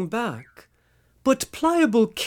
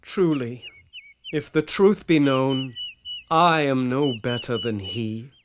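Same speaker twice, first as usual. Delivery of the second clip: humble confession; 8 semitones lower than usual. Acted.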